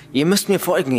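a hurried command to follow; urgent shout. A narrator's reading. Boy urgently summons his parents.

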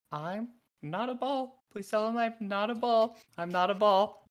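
a tiny critter begging not to be played with; squeaky Otherthorn. Little Otherthorn pleads with the dog, high and nervous. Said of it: "and Otherthorn is just like".

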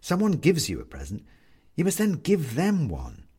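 plain reading voice

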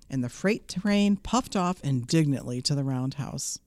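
ordinary delivery

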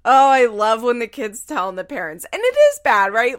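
loud, delighted outburst; excited. Real, not acted. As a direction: gush about it loudly. Delighted by the kid's reply.

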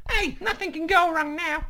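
very high squeaky cartoonish imitation; Jesse voice. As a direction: pitch it far up into a falsetto character voice. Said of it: "That's my Jesse voice".

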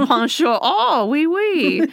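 high, playful fake French; mock French. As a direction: ham up a silly French greeting.

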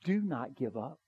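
ordinary talk, nothing marked

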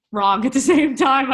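high laughing disbelief. Real, not acted. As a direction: break into laughter at the memory. Recalling shock at scan.

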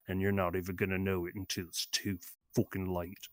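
little girl turns gangster, lightly voiced London menace; British gangster. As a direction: threaten like a Guy Ritchie thug, lightly voiced. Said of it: "this little girl is a Peaky Blinder?".

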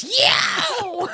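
high, loud eighties rock wail; metal singer yell. Shown as a demonstration. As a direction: belt it like a hair-metal frontman. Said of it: "I sing like this".

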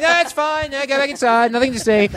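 very high, strained cover-up; frantic reassurance. Retold or imitated. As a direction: wave everyone away in a high call. Calling neighbours back inside, covering up.

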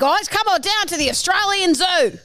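high, excitable Aussie wildlife host; hammed-up Australian zoo showman; Steve Irwin. Contestant starts an impression of an icon, excitable Aussie showman. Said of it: "Steve Irwin".

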